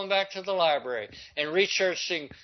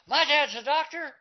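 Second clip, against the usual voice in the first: yelled out; 9 semitones higher than usual. Retold or imitated. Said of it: "And I yelled out".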